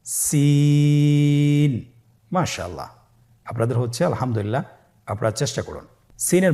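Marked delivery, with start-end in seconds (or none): 0.1-1.7 সিন held long for three alif lengths; সিন drawn out long, a teacher's model; drawn-out letter. Shown as a demonstration. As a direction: stretch সিন slowly, the hiss steady. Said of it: "আবার বলুন".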